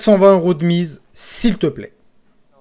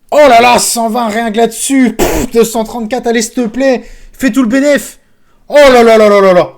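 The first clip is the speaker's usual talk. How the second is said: loud, higher-pitched excited outburst; thrilled; 5 semitones higher, 9 dB louder than usual. Real, not acted. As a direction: exclaim over the win, building to a cascade. Celebrating a slot win. (exclamations surexcitées)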